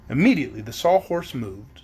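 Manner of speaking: usual speaking voice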